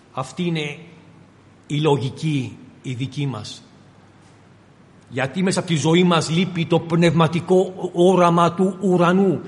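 ordinary talk, nothing marked

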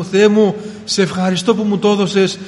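retold cry of faith. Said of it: "Και φώναζα με πίστη στο Θεό".

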